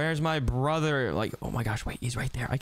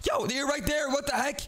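high excited yell of discovery; much higher surprised yo; startled shout; 10 semitones higher than usual. Real, not acted. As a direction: shout it as you spot him. Suddenly finds brother on roof.

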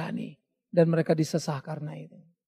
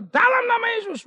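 commanding shout; 5 dB louder than usual. Acted. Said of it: "Ketika Petrus berkata".

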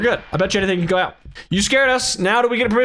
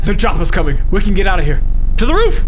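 loud call; 9 dB louder than usual. Real, not acted. Loud music moment.

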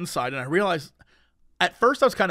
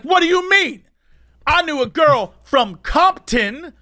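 high, slow, put-on protest; mock indignant drunk; 8 semitones higher, 5 dB louder, slower than usual. Drunk guy objecting to the security guard, mock outraged holler. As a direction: imitate a drunk wailing his objection. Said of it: "he's like".

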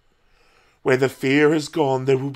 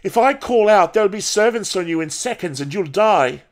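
high, raised, urgent threat; defiant threat; 6 semitones higher, 3 dB louder than usual. A narrator's reading. Paul threatens the old woman with servants, defiant and high. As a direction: throw the threat at her boldly.

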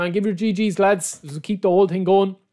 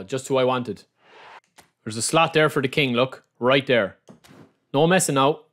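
usual speaking voice

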